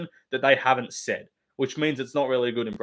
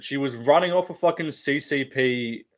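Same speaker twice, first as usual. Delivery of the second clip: normal talking voice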